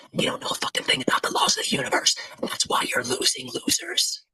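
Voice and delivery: a long taunting whisper of insults; whispered mockery aimed at the audience. Shown as a demonstration. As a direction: breathe the insults out, no voice at all. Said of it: "What if I whisper it like this?".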